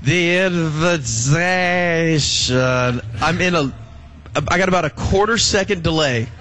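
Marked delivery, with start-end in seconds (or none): 0.0-3.7 put-on slow, dragged-out drunk-sounding announcer; slurred announcer imitation. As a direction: stretch it out like a tipsy emcee. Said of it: "the North Texas guy that it's like".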